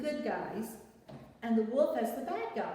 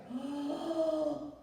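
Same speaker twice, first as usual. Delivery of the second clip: big breath in; 4 semitones higher than usual. Acted. Wolf breathing in hard to blow the brick house. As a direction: fill up with a huge breath. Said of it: "So he got his breath".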